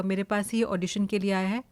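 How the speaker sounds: ordinary talk, nothing marked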